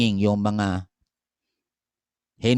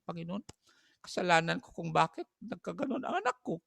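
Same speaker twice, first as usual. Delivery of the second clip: soft prayer; 7 semitones higher, 8 dB quieter than usual. Pretend. Mother's confession prayer to God.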